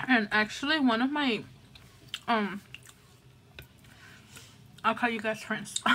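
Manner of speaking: speaking between bites; talking while chewing. Real, not acted. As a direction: mouth partly full. Seen 3: Pursed, moving lips between bites; takes another forkful at 4.5.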